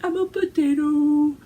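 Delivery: a hint of the girl's tiny reel voice; imitating cute little girl. Quoting the little girl from a reel, lightly childlike. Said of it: "adorable reel that was just like".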